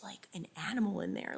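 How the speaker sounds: breathy whispered aside, barely voiced. Real, not acted. Vlogger whispering a secret aside to her viewers. Hushed aside about the baby.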